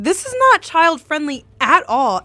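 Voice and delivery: high, loud retold protest; mock outrage. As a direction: complain to mom in hammed-up indignation. Retold mock complaint to mom.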